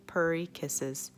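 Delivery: ordinary delivery, nothing marked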